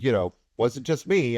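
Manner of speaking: ordinary talk, nothing marked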